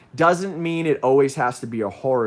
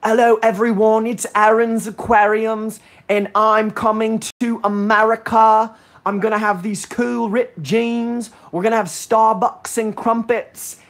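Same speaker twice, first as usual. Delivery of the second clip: high, loud hammed-up English accent, slowish; mock English accent; 9 semitones higher, 5 dB louder than usual. Streamer mimics Aaron's English accent for the chat, hammed-up English. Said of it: "Aaron, I'm gonna try to mimic you".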